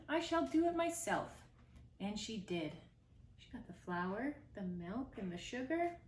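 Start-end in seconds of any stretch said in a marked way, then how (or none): 0.1-1.3 higher, firm resolve; determined hen. Acted. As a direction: take it on herself, decided.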